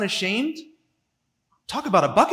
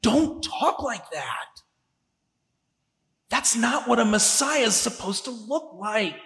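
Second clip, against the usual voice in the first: Peter taking him aside; 2 semitones higher, 4 dB quieter than usual. Acted. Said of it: "Peter is already taking him aside to say".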